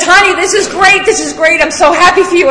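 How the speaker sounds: high, loud, gushing praise; sponsor's delight. Retold or imitated. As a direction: gush like a thrilled sponsor. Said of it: "and she says".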